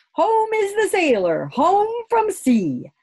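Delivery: a proud, contented call. Acted. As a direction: Grumble sings out the line, pleased. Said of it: "said Grumble contentedly to himself".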